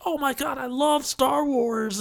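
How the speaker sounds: mock gush. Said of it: "I've still never been like".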